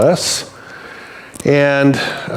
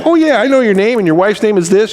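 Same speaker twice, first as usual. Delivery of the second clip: chatty neighbour; 7 semitones higher than usual. Retold or imitated. Voicing the neighbour who knows everything.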